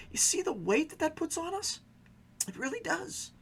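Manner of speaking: normal talking voice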